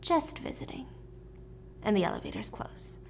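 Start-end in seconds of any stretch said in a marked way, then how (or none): 0.0-0.9 soft, half-whispered throwaway; hushed quip. Acted. Killers in the elevator after the shooting, hushed quip. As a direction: say it very softly, almost airily.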